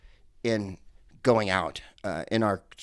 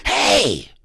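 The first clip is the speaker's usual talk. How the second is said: 1 startled yell; 4 semitones higher, 6 dB louder than usual. Retold or imitated. Debbie yells at golfers.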